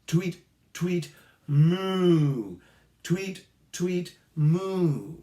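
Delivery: chirp, chirp, then a big moo; tweet and moo pattern. Shown as a demonstration. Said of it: "We could go".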